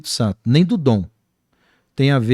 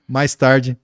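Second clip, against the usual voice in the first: ordinary talk, nothing marked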